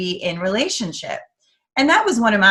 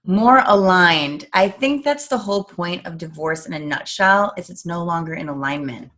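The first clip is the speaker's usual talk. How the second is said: ordinary talk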